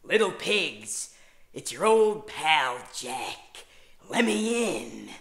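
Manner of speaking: slow, loud, menacing drawn-out call; creepy Jack Torrance. Retold or imitated. Babysitter playing the Shining axe killer at the bathroom door. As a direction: taunt them slowly like a stalking killer. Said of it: "I'd be like".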